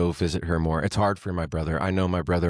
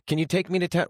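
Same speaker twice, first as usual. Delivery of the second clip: much higher, pleading mom voice; higher mock old mother asking; imitating mom; 10 semitones higher, faster than usual. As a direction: mimic his mother asking again. Voicing confused mom's request.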